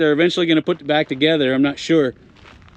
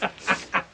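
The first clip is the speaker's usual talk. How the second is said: soft staccato chuckle; quiet, rapid ha-ha-ha; 8 dB quieter than usual. Real, not acted. Laughs at his own bit.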